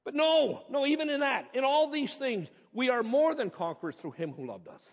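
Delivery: rising preacher's proclamation, loud at the start; preaching. Acted. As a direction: proclaim it from the pulpit. Preaching Romans with force.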